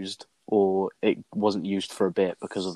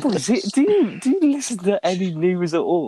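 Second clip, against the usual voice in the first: can you believe it, pitched up; incredulous; 9 semitones higher, 4 dB louder than usual. Real, not acted. Asking friends who never heard of the shooting, higher and louder in disbelief.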